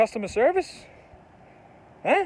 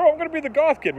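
mocking goth kid; 10 semitones higher, 3 dB louder, faster than usual.

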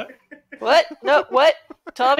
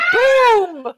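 higher, louder boom; mock shout; 8 semitones higher, 5 dB louder than usual. Reading the review's big moment, mock shout. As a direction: shout it out triumphantly.

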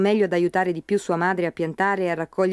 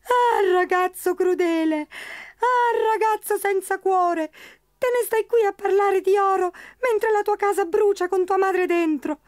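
high squeaky wailing leprechaun, slow; tiny creature sobbing theatrically; pitiful crying; 11 semitones higher, slower than usual. Acted. Leprechaun crying pitifully at Pat, high wailing squeak. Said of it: "scoppiò a piangere in maniera pietosa".

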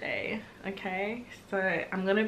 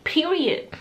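Period punched higher and louder; emphatic period; 6 semitones higher, 4 dB louder than usual. Real, not acted. Fan seals her praise for the singer emphatically. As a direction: slam it down as final.

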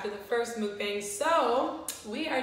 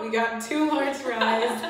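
slower and louder, said again clearly; louder repeat; 7 dB louder, slower than usual. Real, not acted. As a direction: repeat it so it carries. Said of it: "My voice just cut out".

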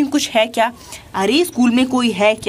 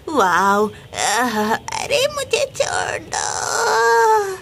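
pained pleading cry; 2 semitones higher, much slower than usual. Acted. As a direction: beg for mercy through tears. Cow attacked by tiger.

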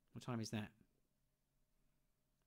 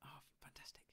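whispering; 19 dB quieter than usual. Real, not acted. Soft whispered praise.

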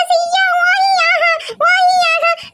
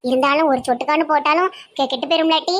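put-on much lower, grumbling old auntie; low old village woman; faster than usual. Old auntie frets to her sister about the pickle jars, low gruff voice.

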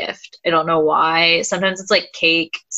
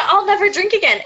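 put-on sorority girl wail; 12 semitones higher than usual. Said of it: "It's the".

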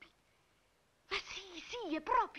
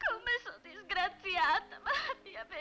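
moved to tears; 6 semitones higher than usual. Acted. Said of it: "mi sono commossa".

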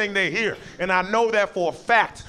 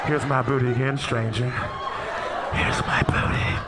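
low, sly here's my booty; understated cheeky warning; sheepish mock apology; 5 semitones lower than usual. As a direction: mutter it cheekily past a stranger's face. Rear past stranger's face.

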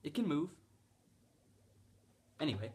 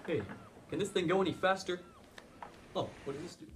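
ordinary delivery

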